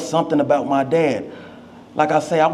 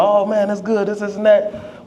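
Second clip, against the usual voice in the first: put-on excited kid; 6 semitones higher than usual. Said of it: "I'm so excited".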